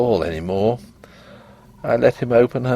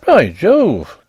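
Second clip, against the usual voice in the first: higher, louder burst of alarm; startled exclamation; 5 semitones higher, 6 dB louder than usual. A narrator's reading. Doctor startled by the broken statue hand, loud alarmed exclamation. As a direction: blurt it out, suddenly alarmed.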